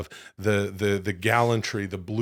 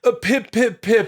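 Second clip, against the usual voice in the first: much higher, louder "Uh, pip, pip, pip"; hammed-up posh catchphrase; 11 semitones higher, 4 dB louder than usual. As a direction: ham up a fake-posh British wrestler on the mic. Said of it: "he would take the mic and he would go".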